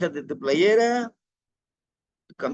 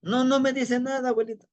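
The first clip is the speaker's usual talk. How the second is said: put-on frustrated young man; 7 semitones higher than usual. Boy frustrated with grandfather.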